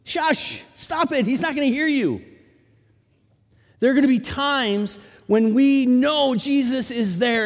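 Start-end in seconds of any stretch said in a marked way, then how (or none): 0.0-2.2 hushing crowd. Retold or imitated. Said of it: "the crowd around him said".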